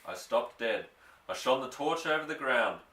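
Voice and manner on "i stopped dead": ordinary delivery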